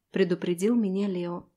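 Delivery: ordinary delivery, nothing marked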